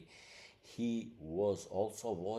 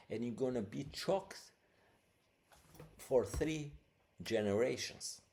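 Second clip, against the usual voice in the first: mock threat; 4 semitones higher, 4 dB louder than usual. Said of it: "she said".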